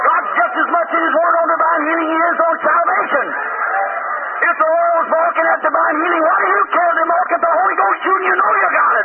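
high, fervent revival preacher; impassioned pulpit climax, pitched high; full-force preaching. Real, not acted. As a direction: cry it out to the congregation in exultation.

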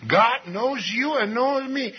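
usual speaking voice

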